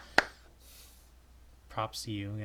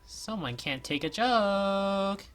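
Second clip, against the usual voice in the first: slow teasing; 9 semitones higher, 5 dB louder, much slower than usual. Acted.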